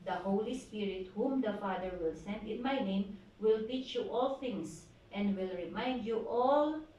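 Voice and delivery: ordinary talk, nothing marked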